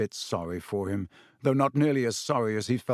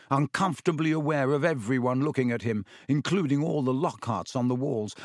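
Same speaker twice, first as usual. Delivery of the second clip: ordinary delivery, nothing marked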